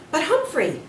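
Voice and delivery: put-on boy protesting to teacher. A narrator's reading. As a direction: object urgently. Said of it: "he protested".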